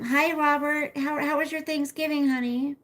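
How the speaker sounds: greeting the neighbor Robert over the fence, calling out; calling out hello. Real, not acted. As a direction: call out across the yard, cheery.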